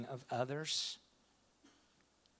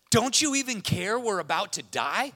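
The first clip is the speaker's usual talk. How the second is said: high, loud acted plea; desperate disciple; 9 semitones higher, 10 dB louder than usual. Disciple confronting Jesus in the storm, high loud plea. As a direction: cry out in mock desperation. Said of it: "he says".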